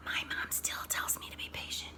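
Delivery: hushed whisper aside; conspiratorial whisper to the listeners. Real, not acted. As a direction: whisper it like a secret. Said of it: "I whispered".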